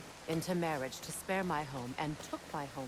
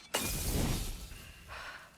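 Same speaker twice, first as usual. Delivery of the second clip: long unvoiced exhale; a weary, spent sigh; heavy sigh. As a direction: let the anger drain out. After the quarrel.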